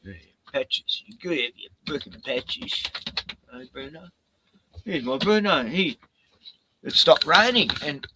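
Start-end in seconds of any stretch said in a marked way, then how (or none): none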